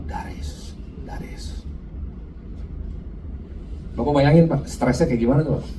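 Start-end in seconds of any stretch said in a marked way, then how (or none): 0.1-1.7 very soft, voiceless murmur; whispered prayer. Retold or imitated. Preacher retells praying over the oil on his chest, whispering. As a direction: whisper it like a private prayer, repeated.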